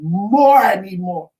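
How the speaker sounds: dramatized pained demand, first word punched; retold screaming plea. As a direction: imitate yourself begging loudly in pain. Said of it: "I still was screaming".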